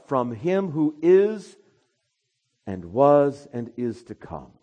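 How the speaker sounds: full-force preaching. Acted. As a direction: proclaim it slowly from the pulpit. Preacher proclaiming scripture.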